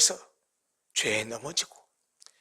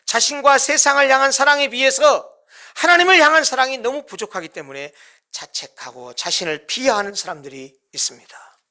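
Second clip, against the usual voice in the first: usual speaking voice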